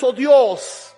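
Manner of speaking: ordinary talk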